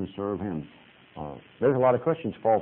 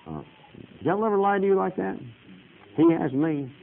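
normal talking voice

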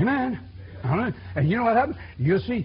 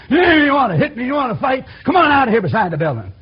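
loud, higher taunting to fight; angry challenge; 5 semitones higher, 6 dB louder than usual. Retold or imitated. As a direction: dare him to step outside. Father challenging a teenager to fight.

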